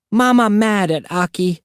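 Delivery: put-on high small-child voice; toddler voice. A narrator's reading. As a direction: tell it like a toddler in trouble. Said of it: "Aki said".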